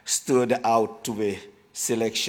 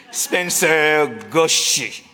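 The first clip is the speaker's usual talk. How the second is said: put-on playful French accent reading names, higher; hammed-up French accent announcing nominees; 5 semitones higher than usual. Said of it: "I will maybe do some Spre-, French accent".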